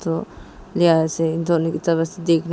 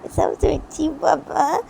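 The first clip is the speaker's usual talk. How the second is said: little daughter's voice; 9 semitones higher than usual. Acted. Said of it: "लिया बोलती कि". (छोटी बच्ची की ऊँची आवाज़)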